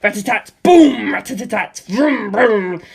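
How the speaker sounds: loud rat-a-tat and engine vroom; mock machine-gun and engine sounds; voiced machine gun. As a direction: make the dogfight noises.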